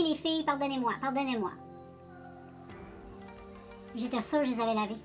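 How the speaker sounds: ordinary talk, nothing marked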